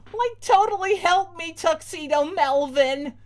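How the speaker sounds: high loud ditzy cry for help; valley girl yell. Acted. Ditzy girl eaten by a dog yelling for help. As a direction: wail like a ditz being eaten. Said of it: "she yells".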